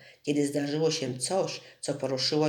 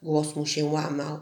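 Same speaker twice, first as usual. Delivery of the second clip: ordinary delivery, nothing marked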